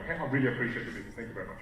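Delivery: very fast, low, trailing off softly; hurried, toneless mumble of thanks; words thrown away. Shown as a demonstration. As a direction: rattle off thanks with no tonality. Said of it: "we just throw them all away".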